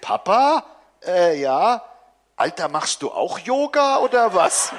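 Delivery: put-on a cheeky teen checking on his dad; teen son. A narrator's reading. Teen son calls through door. (höher, lauter frecher Teenager)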